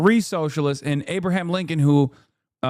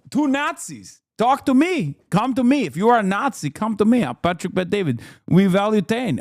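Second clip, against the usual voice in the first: mocking imitation; 4 semitones higher than usual. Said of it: "This fucking guy is so funny. He's like".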